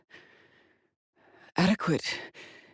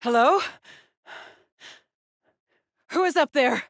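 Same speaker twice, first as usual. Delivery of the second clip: high, raised, alarmed call; 9 semitones higher, 4 dB louder than usual. Acted. Explorer calling to whoever is up there, loudly. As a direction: call up to someone out of sight.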